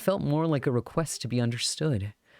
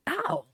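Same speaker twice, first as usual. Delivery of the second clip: sharp high yelp. Acted. Elbowed in the ribs mid-sentence.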